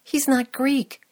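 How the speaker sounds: retold frustration; frustrated. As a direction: insist in mild exasperation. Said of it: "In frustration".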